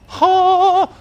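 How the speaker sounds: high, loud, long intake of life; theatrical back-to-life gasp; reviving gasp. Acted. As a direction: gasp as if jolted back from death. Boy gasps back to life.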